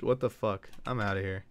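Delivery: usual speaking voice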